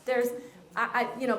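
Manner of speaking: ordinary talk, nothing marked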